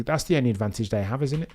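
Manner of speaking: ordinary talk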